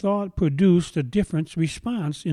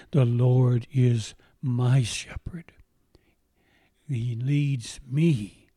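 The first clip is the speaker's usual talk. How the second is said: slow hushed whisper; whispered recitation; 2 semitones lower, slower than usual. Real, not acted. Repeating the verse after invitation.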